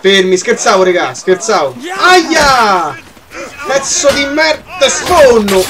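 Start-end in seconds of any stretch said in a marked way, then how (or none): 2.0-5.6 high, heated threat; yelp, then threaten at a high pitch; angry yell. Real, not acted. Gamer hit by an enemy, angry yelling.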